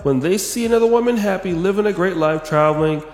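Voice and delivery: high-pitched mock read-out; put-on high commenter voice, easing toward normal at the end; [name].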